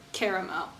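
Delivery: "Caramel" in three syllables, CARE-uh-mel; fancy pronunciation. Shown as a demonstration. Guessing how the band pronounces it, "Caramel" as CARE-uh-mel. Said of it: "They're probably fancy".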